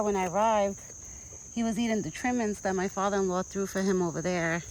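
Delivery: ordinary talk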